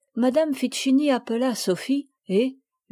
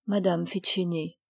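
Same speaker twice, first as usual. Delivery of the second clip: usual speaking voice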